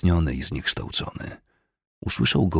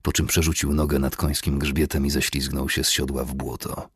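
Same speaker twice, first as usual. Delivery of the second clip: ordinary delivery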